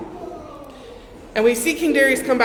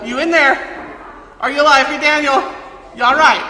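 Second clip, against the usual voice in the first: loud, higher mock calling out; yelling down the hall; 3 semitones higher, 4 dB louder than usual. King Darius shouting for Daniel down the hall, loud yell. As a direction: call it down the hall to someone out of sight. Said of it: "I just imagine yelling down the hall".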